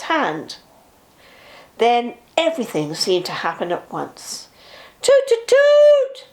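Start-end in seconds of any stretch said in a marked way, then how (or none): 5.0-6.3 slow, high, loud bellow; bellowing toot. A narrator's reading. Bertie bellowing to stop the train, high, loud and slow. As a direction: bellow the horn across the yard. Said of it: "bellowed Bertie".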